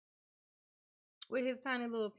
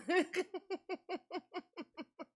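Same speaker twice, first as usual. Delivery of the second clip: high laugh; 8 semitones higher than usual. Real, not acted. Lacht om tomatenspel.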